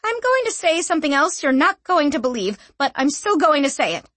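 insist, cocky and bold; feisty young woman. A narrator's reading. Young woman voiced by narrator.

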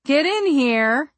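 loud slow urgent yell. A narrator's reading. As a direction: yell for help. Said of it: "I yelled over my shoulder".

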